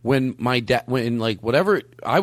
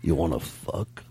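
creepy whisper; 8 semitones lower, 8 dB quieter than usual. Pretend. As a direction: whisper it right into the mic. Mock come-on into the mic.

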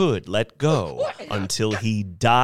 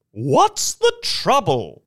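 high, slow, raised-voice question; crying out; 8 semitones higher, 4 dB louder than usual. A narrator's reading. Woman calling out to Mister Thurkle about the delay, crying out. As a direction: call it out high and drawn out. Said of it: "a woman cried".

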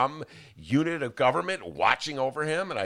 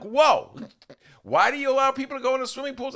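loud, high burst of disbelief; shocked protest; 6 dB louder than usual. Retold or imitated. Retelling a reaction to the briefing, shocked protest. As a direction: blurt it out, astonished.

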